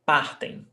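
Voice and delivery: Partem with weight on "PAR"; stress before last. Shown as a demonstration. Teacher repeating the plural form, first-syllable stress. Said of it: "Stressed syllable before last".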